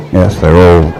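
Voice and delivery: normal talking voice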